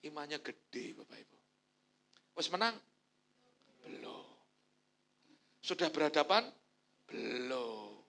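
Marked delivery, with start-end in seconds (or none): none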